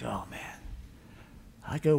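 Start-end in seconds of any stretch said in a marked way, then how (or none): 0.0-0.4 low, soft disappointed groan under the breath; muttered dismay. Retold or imitated. To himself, seeing Dave at the next desk, soft dismay. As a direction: sigh it out like a letdown. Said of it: "I'm like".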